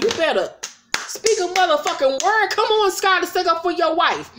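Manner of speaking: high hammed-up urging at Scott; mock urging. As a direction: egg him on, pitch climbing. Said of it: "And I was like".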